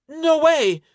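very high, loud squeal; emphatic exclamation. A narrator's reading. Fake nun denies being an adventurer, emphatic squeal.

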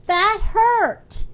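acted hurt outcry; pained complaint. A narrator's reading. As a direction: protest as if just stung. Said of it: "he cried".

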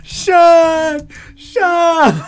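high, loud repeated desperate calls; frantic yelling. Retold or imitated. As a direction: yell the name over and over, panicking.

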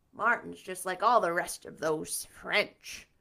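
in disgust. Acted. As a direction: drag it out with contempt. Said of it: "said Marilla in disgust".

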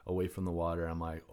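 usual speaking voice

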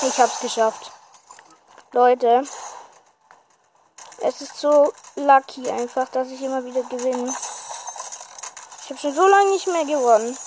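loud, joyful celebration; excited victory cheer. Real, not acted. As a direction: cheer loudly after winning. Just won the match.

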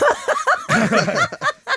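high, loud cackling laugh; real high-pitched laughing fit. Real, not acted. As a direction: crack up at your own joke. Cat on the bread joke.

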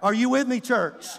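normal talking voice

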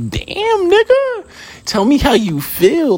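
high-pitched, hammed-up disbelief; mock shock. Reacting to a friend who hates to see you win, mock shock. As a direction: exclaim it way up high, playing stunned.

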